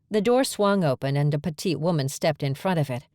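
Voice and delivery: ordinary delivery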